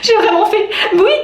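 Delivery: high, laughing disbelief; nervous laughter. Real, not acted. Woman admits she gave in to the robbers, laughing nervously. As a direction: laugh nervously through the words. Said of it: "Je rigole, c'est nerveux".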